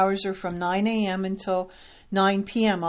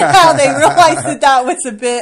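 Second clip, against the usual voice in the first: laughing while talking; 6 semitones higher, 10 dB louder than usual. Real, not acted. Laughing about thirty-six page rulebook.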